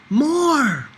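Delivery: high, loud, delighted cry; eager demand. A narrator's reading. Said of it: "Pablo said".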